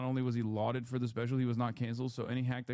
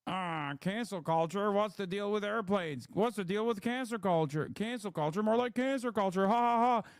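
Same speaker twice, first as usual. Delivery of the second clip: higher-pitched hammed-up stand-up delivery; mock a hack comic, pitched up, ending in fake laughter; mocking comedian quote; 6 semitones higher than usual. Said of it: "where he was like".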